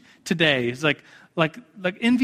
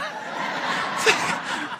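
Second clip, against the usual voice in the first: laugh; 4 dB quieter than usual. Real, not acted. Dad laughs at son.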